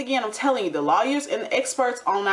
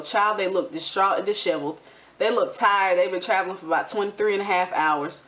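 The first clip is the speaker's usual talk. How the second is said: usual speaking voice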